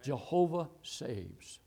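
ordinary talk

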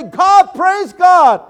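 high, loud, slow cries of praise; jumping for joy, shouting praise to God; joyful shouting. Retold or imitated. Preacher leaping after a convert's call, shouting praise.

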